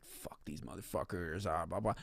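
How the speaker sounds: low, soft muttered grumble; imagined player grumbling about his bosses, low and soft; mock grumbling.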